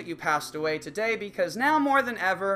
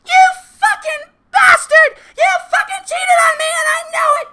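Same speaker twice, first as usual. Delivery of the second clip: screeching much higher and louder, fast and furious; hysterical girlfriend; 16 dB louder than usual. Acted. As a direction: scream it at him like a jealous girlfriend gone wild. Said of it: "a hysterical bitch who's getting in your face going".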